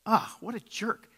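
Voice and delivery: high, slow sneer at himself; mocking inner jerk voice. Quoting his own grumbling thought, a slow high sneer. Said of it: "I was just like".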